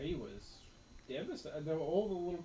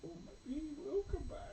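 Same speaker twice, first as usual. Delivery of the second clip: put-on a much higher, tearful little kid's voice; hopeful little boy; 13 semitones higher than usual. Mom imitating her small son hoping Superman returns, high and teary. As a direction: voice a hopeful child near tears. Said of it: "Kevin, he said".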